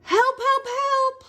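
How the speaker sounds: very high-pitched mock cry for help. A narrator's reading. Reader voices the stuck blue truck, high cry for help. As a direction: squeak out a little truck's distress call. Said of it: "cried the little blue truck".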